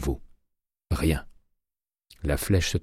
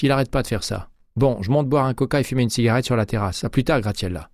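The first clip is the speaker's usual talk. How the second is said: slightly higher-pitched woman colleague; lightly voiced Patrice, irritated then casual; 7 semitones higher, 4 dB louder than usual. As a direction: lightly act a colleague grumbling, then taking a break. Narrator voices Patrice's chatty exit. (voix de collègue légèrement jouée, plus aiguë)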